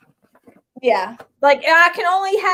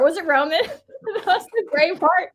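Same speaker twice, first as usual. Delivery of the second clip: ordinary talk, nothing marked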